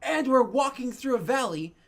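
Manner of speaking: loud, high alarmed warning; alarmed shout. Acted. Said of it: "She's like".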